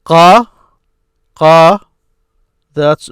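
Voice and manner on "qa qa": QA held long and loud on its own; "Qa" projected as an isolated teaching syllable; syllable demonstration. Shown as a demonstration. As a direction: sound out "Qa" as one long, separate syllable. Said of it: "break it down to syllables, this is what you hear".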